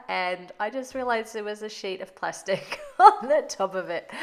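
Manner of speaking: amused by her own blunder, real laughter running through the line; laughing at herself. Real, not acted.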